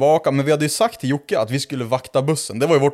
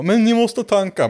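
higher-pitched imitated kid; bargaining teen kid; 8 semitones higher than usual. Car kid sets terms for strangers, high mock voice. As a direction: lift the voice like a kid haggling. Kids set a condition.

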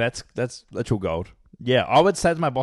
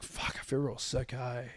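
soft, pathetic fake-sick moan; mock sick voice; 12 dB quieter, slower than usual. Apprentice telling his boss he feels sick, a soft fake-sick moan. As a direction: lightly put on a poorly apprentice.

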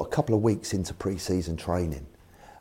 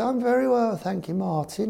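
put-on high-pitched posh accent; Eddie Rogers. Chairman answers his manager politely, mock posh high voice. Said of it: "'cause very posh, Eddie".

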